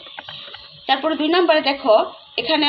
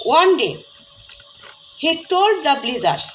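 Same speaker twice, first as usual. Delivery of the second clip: slow, careful reading of a story line; story read aloud; 3 semitones higher, 4 dB louder, much slower than usual. Shown as a demonstration. Teacher to students, reading the story sentence slowly. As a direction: read it slowly so the class can follow.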